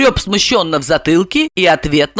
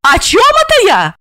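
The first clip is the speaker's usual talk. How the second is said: very high, louder outraged repeat; indignant echo; 5 dB louder than usual. A narrator's reading. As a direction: throw his question back, pitched high. Asya echoes his question indignantly. (возмущённо переспрашивая, высоким голосом)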